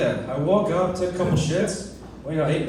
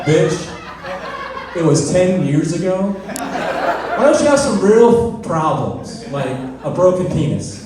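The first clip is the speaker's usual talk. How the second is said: louder, exasperated telling-off; mock angry rant; 5 dB louder, slower than usual. To the sister's ex at the door, an exasperated louder rant. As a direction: snap at him and laugh it off. Yelling at the ex-boyfriend.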